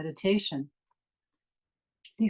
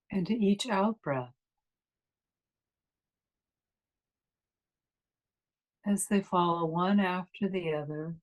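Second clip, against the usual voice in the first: guided meditation. Acted. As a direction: ease them inward, unhurried and calm.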